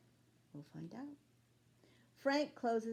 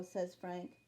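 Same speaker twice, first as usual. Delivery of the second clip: ordinary delivery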